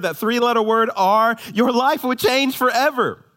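high, loud, fast urgent preaching; preaching at full force. Acted. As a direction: pound the promise home to the congregation.

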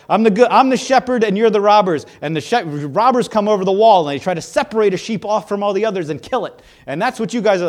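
Jesus losing patience with the Pharisees, loud and insistent; exasperated Jesus. Acted. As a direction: jab the finger at them with every line.

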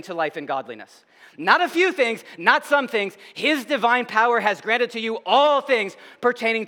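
high, loud, emphatic sermon delivery; forceful preaching. Real, not acted. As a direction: drive each phrase home from the pulpit.

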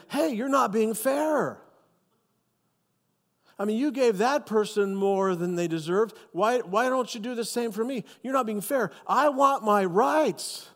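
high, slow complaining whine; mock the grumblers, high and whiny; hammed-up grumbling worker; whiny complainer. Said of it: "they're probably saying".

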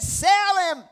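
loud, high shouted order; furious command. Acted. King ordering the debtor sold, shouted in anger. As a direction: shout the sentence out.

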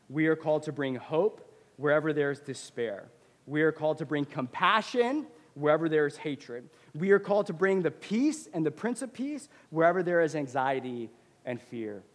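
rally the church line by line; preaching. Acted. Pastor calls the church to its mission, building preaching refrain.

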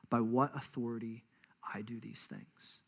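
hushed, breathy whisper. Retold or imitated. Jesus refusing to tell the leaders, whispered. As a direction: let the line sink into a whisper.

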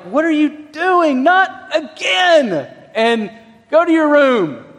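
dad yelling at his son over a broken table. Retold or imitated. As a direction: reenact scolding the boy, stretching "Not again". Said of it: "I yell at Johnny".

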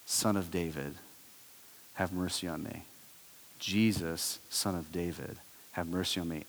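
a blind beggar pleading from the roadside, hushed cry; hushed desperate plea. Acted. Beggar calls to Jesus from the roadside, soft desperate plea. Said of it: "he yells out something".

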